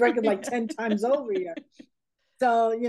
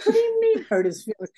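mock indignant; 12 semitones higher, 4 dB louder than usual. Retold indignant reply to an accusation.

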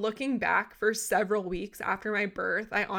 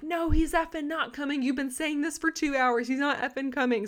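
higher, exasperated mock snapping; retold anger; 6 semitones higher than usual. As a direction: throw it back at them, fed up. Yelling at staff in labor.

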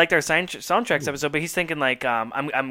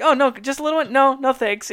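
higher, both sides of a hug plea; mock back-and-forth; 8 semitones higher, 4 dB louder than usual. [name] pleading and [name] refusing, both imitated. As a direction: play the pleading fan and the refusal. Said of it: "And she's like".

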